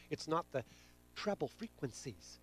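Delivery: ordinary talk, nothing marked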